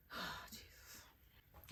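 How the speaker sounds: breathy whisper of relief; a spent, whispered oh Jesus; exhausted sigh. Real, not acted. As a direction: sigh it out, drained.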